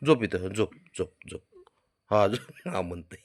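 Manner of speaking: soft, low lulling. Real, not acted. Owner lulling the dog to sleep, soft and low. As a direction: coax him to sleep softly.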